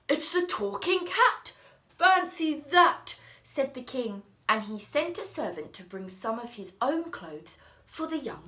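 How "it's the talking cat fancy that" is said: louder, higher, amazed exclaim. Acted. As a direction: marvel at the talking cat. King amazed at cat.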